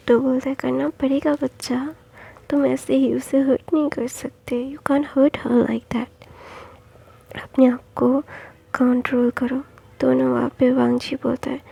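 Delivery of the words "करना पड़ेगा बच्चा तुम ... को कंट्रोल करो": whisper. Acted. Father calming son, whispered.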